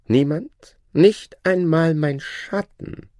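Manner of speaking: high, scheming whisper-thought of the shadow; sly shadow voice. Acted. The Shadow privately plotting, sly high voice.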